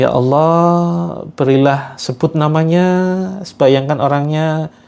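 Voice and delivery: pleading prayer intoned phrase by phrase; earnest guided prayer. Acted. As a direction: lead the group in begging God for strength to forgive. Leads prayer for the offender.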